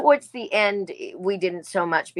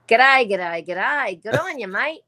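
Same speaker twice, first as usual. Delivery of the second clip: broad Australian accent, loud sing-song greeting; 3 semitones higher, 5 dB louder than usual. Shown as a demonstration. Actress slipping into her Australian accent on the host's request, Australian accent. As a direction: lay on a hearty, swooping Australian greeting. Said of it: "can you slip into the Australian accent".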